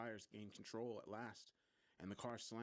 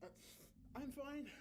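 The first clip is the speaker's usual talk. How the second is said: high, strained, unconvincing reassurance; barely managing. A narrator's reading. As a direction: pretend to be fine while breaking down. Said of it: "I managed. It wasn't convincing".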